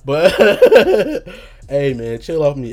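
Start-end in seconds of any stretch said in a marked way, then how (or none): 0.4-1.6 big loud burst of laughter. Real, not acted. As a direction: let a huge laugh explode out.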